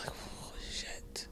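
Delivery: hushed whisper so mom won't hear. Retold or imitated. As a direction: whisper it secretly. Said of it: "Mom's saying".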